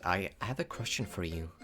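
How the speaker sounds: ordinary talk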